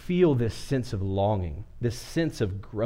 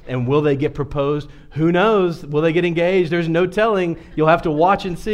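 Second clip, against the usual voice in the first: higher, louder teasing questions, playing up the obvious; mock suspense; 5 semitones higher, 6 dB louder than usual. Real, not acted. Teasing his audience about a predictable movie plot, in mock suspense. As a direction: ham up the fake suspense.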